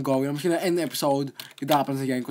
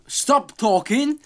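higher, slow exasperated snap; irritated order; 9 semitones higher, 4 dB louder, slower than usual. Real, not acted. Gamer telling people around him to stop talking, irritated. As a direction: shut them up.

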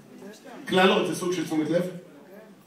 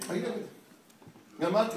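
ordinary delivery

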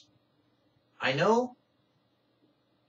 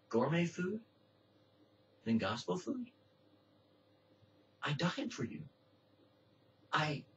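much softer, hushed voice of God; tender plea; 8 dB quieter than usual. Acted. Preacher voicing God pleading softly with the lost. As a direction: plead gently, almost under the breath.

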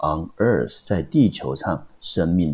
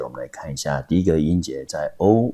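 ordinary delivery

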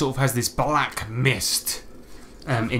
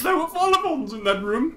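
high, squeaky protest; indignant creature. Acted. Owen protesting to Grimald, high and squeaky.

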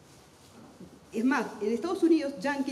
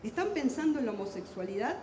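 ordinary delivery

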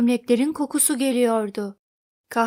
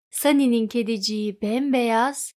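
ordinary delivery

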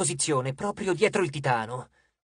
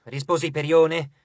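plain reading voice

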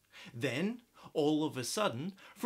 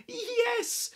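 witch crying yes. Acted. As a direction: shout a happy yes. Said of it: "cried the witch".

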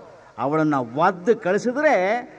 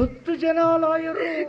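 astonished echo; 10 semitones higher, slower than usual. Acted.

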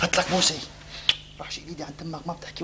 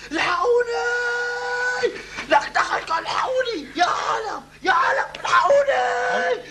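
mock panicked scream. Son mimicking father's night screams, mock panic shout. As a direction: ham up his screaming for help. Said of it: "صرخت صريخ".

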